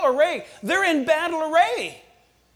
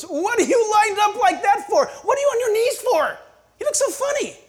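much higher, loud shouted mockery; jeering enemy; 9 semitones higher, 4 dB louder than usual. The enemy shouts ridicule at believers on their knees, high loud jeering.

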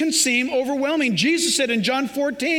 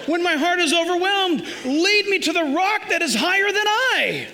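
higher, quoting through a laugh; laughing psalm quote; 6 semitones higher than usual. Acted. Preacher correcting his joke, laughing. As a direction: read the psalm while chuckling. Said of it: "That's not what it says".

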